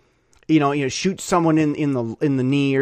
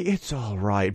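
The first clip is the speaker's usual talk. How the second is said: soothing assassin; 7 semitones lower, 5 dB quieter, slower than usual. Retold or imitated.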